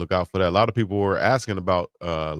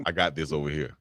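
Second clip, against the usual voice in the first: lightly voiced Uncle Neely; a hint of Uncle Neely brushing it off; 5 semitones lower, 4 dB quieter than usual. Host imitating Uncle Neely to the crew, lightly voiced. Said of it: "gonna do like Uncle Neely".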